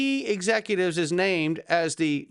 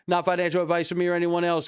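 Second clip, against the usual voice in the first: fast disclaimer; much faster than usual. Real, not acted. Rushed repeated disclaimer.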